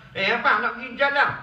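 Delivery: wife scolding her husband for skipping prayer, in mock anger. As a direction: tick him off for skipping maghrib, hammed up a little. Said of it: "Ha, marah gitu".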